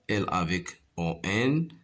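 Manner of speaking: ordinary talk, nothing marked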